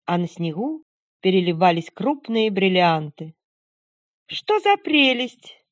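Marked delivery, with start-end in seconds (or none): 4.3-5.6 put-on high, slow delighted girl; young girl voice. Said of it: "сказала молодая девушка".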